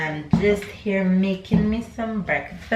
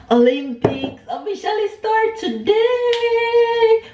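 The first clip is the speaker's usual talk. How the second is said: high, drawn-out sing-song cheer; excited announcement; 4 dB louder than usual. Real, not acted. Vlogger tells viewers the Olympics begin, excited sing-song. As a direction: stretch it out in a high, excited squeal. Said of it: "I am so excited".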